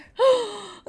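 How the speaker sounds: sharp high gasp; dramatic gasp; mock gasp. Real, not acted. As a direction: gasp in fake shock. Realises they are the killer.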